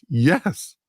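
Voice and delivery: normal talking voice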